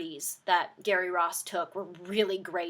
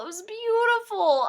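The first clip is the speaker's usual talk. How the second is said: gushing; 13 semitones higher, 5 dB louder than usual. Real, not acted. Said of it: "and it was beautiful".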